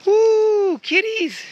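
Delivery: very high, loud squeal at the kitties; excited cat greeting. Real, not acted. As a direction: squeal with delight at cats. Spotting cats.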